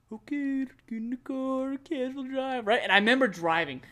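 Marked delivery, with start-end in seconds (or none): 0.1-2.7 higher-pitched, understated calm; mock-casual, unhurried. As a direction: play it easygoing, no rush at all. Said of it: "My experience was like, ... casual drive".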